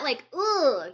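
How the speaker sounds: ordinary talk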